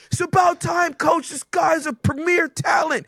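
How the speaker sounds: high mock-outraged parent yelling at the coach; hammed-up proud father, fast and high; pushy dad. Father scolding the coach to play his son. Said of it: "He's like".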